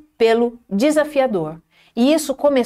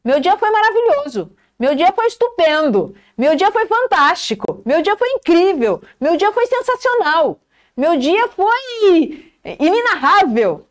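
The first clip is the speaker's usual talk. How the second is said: rising praise, higher and brighter each line, last word slowed; growing enthusiasm; 7 semitones higher than usual. Shown as a demonstration. Coach shows the teacher how to praise a good day, building excitement. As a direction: pile on the delight, word after word. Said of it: "Olha a diferença".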